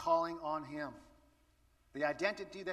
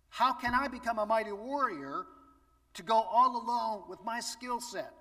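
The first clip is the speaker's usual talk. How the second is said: Gideon doubting; 5 semitones higher, 6 dB louder than usual. Pretend. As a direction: ask it as a man who feels too small. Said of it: "when he says".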